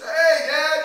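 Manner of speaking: loud, high, over-eager hello; startled greeting. Acted. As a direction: blurt it out like you've been caught. Walks in high, dad sitting there.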